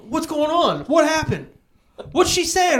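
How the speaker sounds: high, loud anxious mom. Retold or imitated. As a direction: fret loudly in a high mom voice. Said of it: "And Ma's going".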